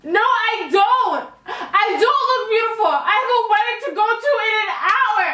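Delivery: high, outraged complaining; upset client. Retold or imitated. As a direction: play the furious bride's sister.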